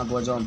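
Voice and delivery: बोजोम with o in both syllables and a j; wrong pronunciation. Shown as a demonstration. Teacher showing students a second misreading, बोजोम said "bo-jom". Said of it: "ना बोजोम पढ़ते हैं".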